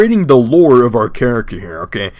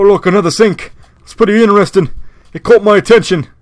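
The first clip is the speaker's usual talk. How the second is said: higher, raspy British rocker putting on a show; lightly hammed-up British rocker accent; 6 semitones higher than usual. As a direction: wander the bathroom as a hardcore British rocker. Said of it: "hardcore, British rocker with, like, a weird, like, raspy voice".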